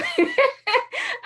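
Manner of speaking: high burst of laughter; laughing. Real, not acted. Speaker laughing at her own impression. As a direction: laugh out at the joke.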